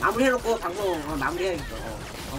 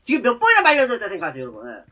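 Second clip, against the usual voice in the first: ordinary delivery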